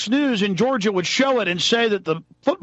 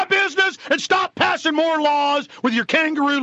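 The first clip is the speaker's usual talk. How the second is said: angry shout; 9 semitones higher than usual. Real, not acted. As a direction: yell it out, fed up. Angry rant at police.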